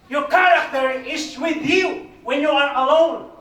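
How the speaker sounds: slow, loud preaching declaration; booming pulpit emphasis; preaching shout. Real, not acted. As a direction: proclaim it slowly at full force to the congregation. Preacher drives home main point.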